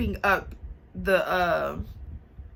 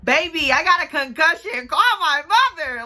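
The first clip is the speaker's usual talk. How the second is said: louder, higher pleading to the sister; urgent plea; 7 semitones higher, 5 dB louder than usual. Retold or imitated. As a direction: beg her urgently to call mom. Pleading with sister to call mom.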